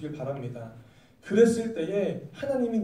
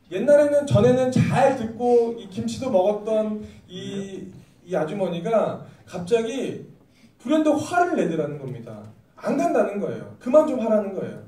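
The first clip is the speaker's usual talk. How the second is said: ordinary delivery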